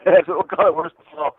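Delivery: laughing. Real, not acted. Laughing while coining catchphrase.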